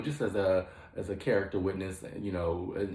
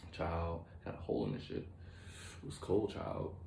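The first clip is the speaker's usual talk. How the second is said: low-voiced muttered aside about the draft; hushed grumble, almost whispered; quiet aside; 3 semitones lower, 10 dB quieter, slower than usual. Real, not acted. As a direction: drop to a near-whisper, half to yourself. Aside about the cold room.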